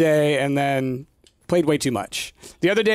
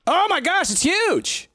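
surprised; 13 semitones higher, slower than usual. Real, not acted. Shocked at the huge plant.

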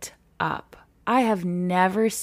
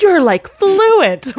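high, loud, slow, over-the-top flattery; gushing praise; 12 semitones higher, 11 dB louder, much slower than usual. Retold or imitated. Chinese speakers overpraising a learner, high loud and drawn out. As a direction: gush it out like doting fans. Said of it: "and they'll be like".

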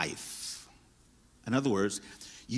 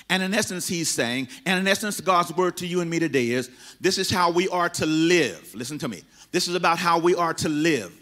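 rising, louder preacher building to a climax; preaching at full force; 7 semitones higher, 4 dB louder than usual. Acted. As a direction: drive it home to the congregation, higher and louder.

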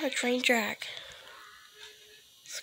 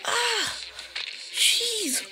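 high, loud gasp of fright; jump-scare yelp; shrieking in shock; startled scream; 8 semitones higher, 4 dB louder, slower than usual. Real, not acted. Sudden monster scare.

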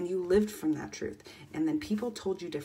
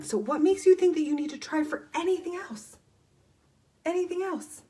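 much higher, pressing the question; incredulous challenge; 11 semitones higher, 4 dB louder than usual. Real, not acted. Coach challenging viewers' beliefs, high and incredulous. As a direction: challenge them, voice soaring up.

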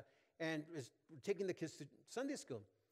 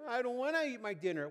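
higher-pitched mock child; protesting child; 9 semitones higher, 6 dB louder than usual. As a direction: imitate a kid refusing, pitched up. Voices a child refusing dinner.

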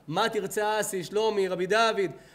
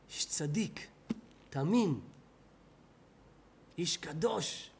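whisper; 3 semitones lower, 6 dB quieter, slower than usual. Real, not acted. Hushed praise of Noah.

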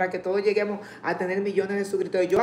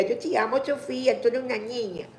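affectionate; 4 semitones higher than usual. Real, not acted. Greeting a child viewer.

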